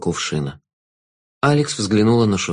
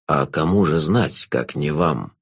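low, slow, velvety jester; sinister jester; 7 semitones lower, slower than usual. Acted.

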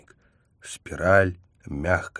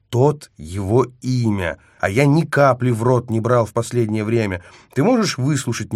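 indignant denial; 4 semitones higher, 5 dB louder than usual. A narrator's reading. He indignantly denies drinking.

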